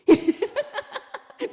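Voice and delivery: loud, high burst of laughter; hearty laugh. Real, not acted. Laughing at her own comeback to a busy remark, hearty laugh.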